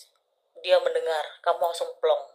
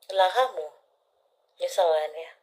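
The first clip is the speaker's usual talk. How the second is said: ordinary talk, nothing marked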